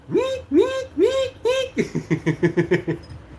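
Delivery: very high imitated horror sting; mock horror soundtrack; horror music hum. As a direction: hum scary movie music.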